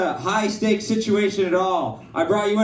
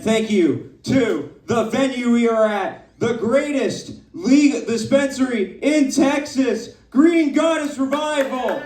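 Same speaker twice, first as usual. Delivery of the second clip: raised pitch, building to the venue name; hyped shout-out; 5 semitones higher than usual. Real, not acted. Host thanking the venue before a crowd, hyped announcement. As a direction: lift it higher as it builds to applause.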